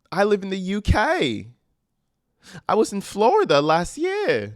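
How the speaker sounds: put-on trying a British accent and giving up, barely British; failed UK accent. Reading Lisa's message in a botched British accent. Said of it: "I can't do the U K accent".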